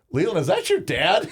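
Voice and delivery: put-on high-pitched kid asking a friend; retold kid's high, curious question; son's buddy. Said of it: "six of his buddies".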